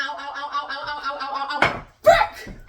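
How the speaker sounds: pained yelps, building to an exclamation; burned by heat. Real, not acted. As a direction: react to touching something very hot. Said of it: "That was so hot".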